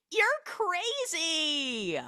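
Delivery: high, very slow mock disbelief; incredulous. Mother telling Ryan he's crazy, slow mock disbelief. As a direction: drag it out, appalled.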